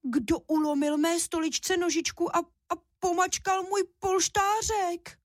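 very high, thin little cub voice; high-pitched wailing baby bear; desperate squeak. A narrator's reading. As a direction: squeal it in despair, slowing toward the end. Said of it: "Ten jenom zoufale vypískl".

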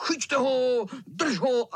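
put-on shouting boys. A narrator's reading. Boys shouting while chasing the cat. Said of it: "křičeli".

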